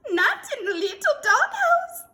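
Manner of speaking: very high, loud squeaky disbelief; exclaiming. A narrator's reading. Scapito hearing of the intruder, exclaiming shrilly. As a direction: squeal it in comic shock. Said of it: "exclaimed Scapito".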